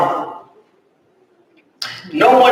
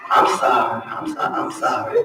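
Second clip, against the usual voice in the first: mock apology; 9 semitones lower, 7 dB quieter than usual. Mock apology after being scolded.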